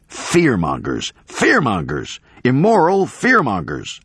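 high, impassioned public tirade; crazed professor playing to the cameras, building to a high cry; ranting denunciation. Professor denouncing Drake to the cameras, ranting.

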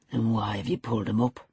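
low, controlled interrogation; lightly voiced low, stern master. A narrator's reading. Master interrogates the young man.

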